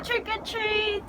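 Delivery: high, drawn-out excited call; excited trick-or-treater. Retold or imitated. Kid at the tent calling for candy, excited. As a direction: call it out slowly with glee. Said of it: "like, so excited".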